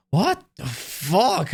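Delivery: mock-annoyed outburst, stretching the words; acted pissed-off. Person always getting pissed at someone, acted exasperation. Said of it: "You're always getting pissed".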